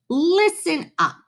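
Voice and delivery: a child's mock-stern command; play-acting the teacher calling the class to order; lightly voiced little girl playing teacher; pretend teacher. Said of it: "Lily pretended to be Mister Slinger".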